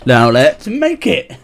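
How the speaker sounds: high, loud, drawn-out catchphrase; Mister Maker. Acted. Mimicking a kids' art show catchphrase for a friend, showy and loud. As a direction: belt it out slowly like an excited show host. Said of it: "if you've watched Mister Maker".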